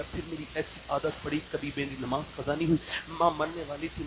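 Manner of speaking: usual speaking voice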